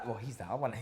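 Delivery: usual speaking voice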